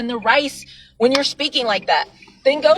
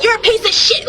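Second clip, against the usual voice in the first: mock hateful insult; 9 semitones higher than usual. Shown as a demonstration. Showing viewers how to insult the rice water, with a mock-angry jab. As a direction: hurl it at the bottle with scorn. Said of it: "go to and be like".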